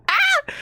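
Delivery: short, very high yelp; startled shriek. Real, not acted. Player startled by the game, short shriek.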